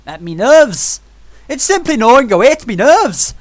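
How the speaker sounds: loud, high mock-Irish outburst; botched part-Irish accent. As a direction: ham up a bad Irish accent. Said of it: "what that accent was. It was, like, part Irish".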